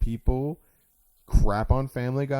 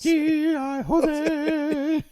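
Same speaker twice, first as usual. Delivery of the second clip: drawn-out pun; much slower than usual. Pretend. Savouring the pun aloud.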